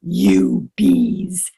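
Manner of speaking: loud mother bear shout, drawn out; furious protective roar. Acted. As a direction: roar them away from the cave. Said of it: "she roared at them".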